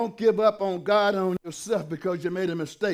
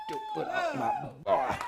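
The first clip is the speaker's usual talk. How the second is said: a sudden, much higher commanding voice for God; God's rebuke. Acted. Preacher voicing God telling the devil to let go, high and commanding. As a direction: cry it out high like a protective father.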